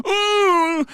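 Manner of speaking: high, loud held "ooh"; character's drawn-out "ooh". Acted. Player voicing Abba the Hutt, drawn-out high "ooh". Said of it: "So I had Abba go".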